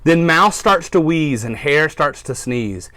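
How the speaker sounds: storybook read-aloud for little listeners; reading to children. A narrator's reading. Teacher reads to her class, storytime voice.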